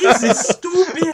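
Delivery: high, slow, loud scoffing character voice; mock African voice.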